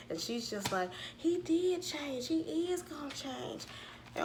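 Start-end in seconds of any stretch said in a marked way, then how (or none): 1.2-3.6 high, drawn-out imitated pleading; mock insistence. Daughter defending her boyfriend to mama, mock-pleading insistence. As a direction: stretch each word slowly, pitched way up.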